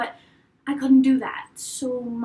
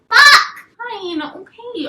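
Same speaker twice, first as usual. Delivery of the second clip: much higher, much louder curse; loud frustrated outcry; exasperated shout; 9 semitones higher, 10 dB louder, much slower than usual. Retold or imitated. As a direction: blurt it out in frustrated surrender. Giving in, exasperated.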